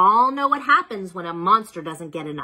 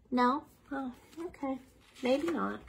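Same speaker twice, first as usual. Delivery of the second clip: much softer, resigned; mock disappointed; 5 semitones higher, 10 dB quieter than usual. Reader accepts the children's refusal, softly disappointed. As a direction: let it drop with pretend letdown.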